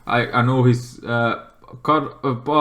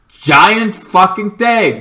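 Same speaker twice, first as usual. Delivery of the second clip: louder, higher and slow, drawn out; awed outburst; 6 semitones higher, 6 dB louder, much slower than usual. Real, not acted. Vlogger describing the monster, slow awed exclamation. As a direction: marvel at it out loud.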